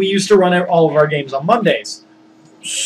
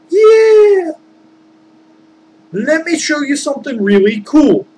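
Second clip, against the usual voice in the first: excited little mortal; 10 semitones higher than usual. Retold or imitated. Abel the little mortal eagerly agreeing with Joe, high excited voice. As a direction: squeal it like a thrilled kid.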